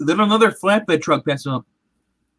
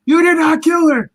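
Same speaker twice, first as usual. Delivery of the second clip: much higher and louder, pleading yell; desperate shout; 11 semitones higher, 5 dB louder than usual. Retold or imitated. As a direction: yell it as if begging it to be true. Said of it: "he just starts telling".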